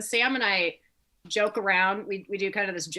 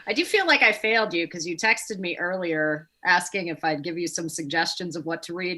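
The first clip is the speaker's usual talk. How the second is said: usual speaking voice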